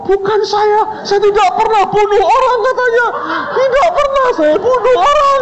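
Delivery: high, loud, fast pleading; panicked denial. Retold or imitated. Terrified student pleading he killed nobody, high and frantic. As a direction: plead your innocence in a panic.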